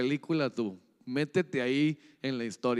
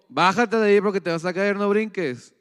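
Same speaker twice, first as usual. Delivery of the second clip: louder, higher scolding mom warning; mother's scolding; 4 semitones higher, 7 dB louder than usual. Retold or imitated. Mother scolding her child, louder and higher. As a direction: scold the kid like an exasperated mother.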